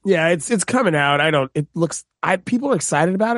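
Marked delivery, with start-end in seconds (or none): none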